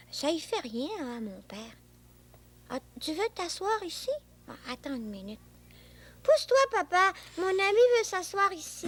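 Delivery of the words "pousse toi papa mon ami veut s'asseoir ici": bossy command. Acted. Boy ordering father.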